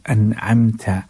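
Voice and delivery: slow chanted Quran verse; Quran recitation. Shown as a demonstration. Teacher recites the verse as a slow chanted demo. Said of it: "अलैहिम नहीं पढ़ना है".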